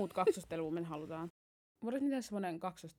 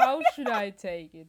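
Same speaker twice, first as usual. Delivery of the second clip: laughing; 2 semitones higher, 8 dB louder than usual. Real, not acted. Mock offended reply, laughing.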